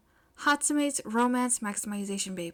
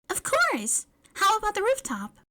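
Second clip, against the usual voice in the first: high, loud excited schoolgirl; eager girl pitched high; eager May; 12 semitones higher, 5 dB louder than usual. Acted. Eager May answers Momo.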